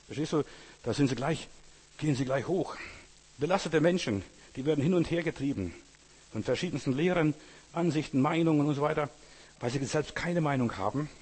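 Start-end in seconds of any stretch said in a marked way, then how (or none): none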